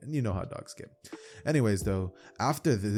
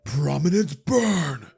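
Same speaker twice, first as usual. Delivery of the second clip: slow, drawn-out furious battle cry, higher and louder; slow, raging anime attack call; angry attack shout; 4 semitones higher, 6 dB louder, much slower than usual. Acted. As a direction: roar the move name like an enraged hero. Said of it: "an angry Endeavor would let out".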